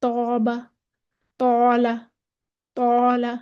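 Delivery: "तौबा, तौला" with a heavy, dark long alif; मोटा अलिफ. Shown as a demonstration. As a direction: fill the mouth on the first vowel of "तौबा, तौला". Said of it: "हम अलिफ को मोटा पढ़ेंगे". ("तौबा, तौला" में अलिफ मोटा पढ़ें)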